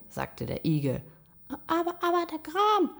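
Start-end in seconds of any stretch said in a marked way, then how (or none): 1.7-2.9 very high, frightened mouse voice; trembling voice. A narrator's reading. Mouse fears the Gram, trembling high voice. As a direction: squeak it timidly, trembling. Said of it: "mit zitternder Stimme".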